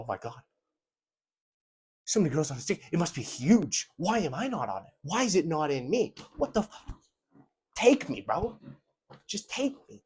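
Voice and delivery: high, breathless fangirl voice rising to a squeal; mocking impression of the girl he imagines; mock swooning girl. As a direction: ham up an imagined girl begging.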